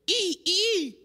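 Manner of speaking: high, rising "ee" cries; imitated shepherd's call. Shepherd showing hired worker a noise, high-pitched call. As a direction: squeal out a strange herding call. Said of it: "make this noise".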